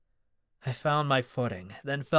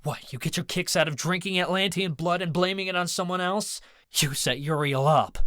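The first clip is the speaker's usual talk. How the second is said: angry accusation; 6 semitones higher than usual. A narrator's reading. Accusing Mad Dog.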